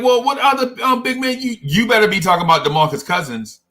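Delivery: incredulous. Retold or imitated. Retold incredulous reply.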